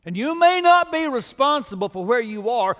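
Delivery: ordinary talk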